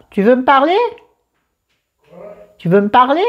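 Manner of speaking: speaking to someone off-camera. Real, not acted. Talks to partner off camera.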